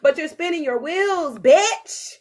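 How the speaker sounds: slow and building to loud high scolding; heart nagging. Retold or imitated. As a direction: lay into the brain, harder each line. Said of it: "my heart is constantly like".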